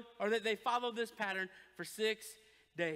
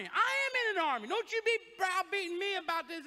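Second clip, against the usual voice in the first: louder, higher and fast, indignant; defensive retort; 6 semitones higher, 4 dB louder than usual. Retold or imitated. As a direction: snap back at the wife, hurt pride. Husband defends himself to wife.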